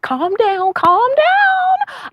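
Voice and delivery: shrill-free playful falsetto, mocking the excited ladies; mock calm down. Hostess teasing her excited ladies, high mock calm down.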